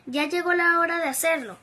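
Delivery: ordinary delivery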